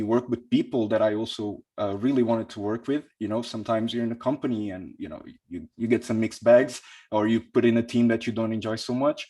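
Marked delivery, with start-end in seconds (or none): none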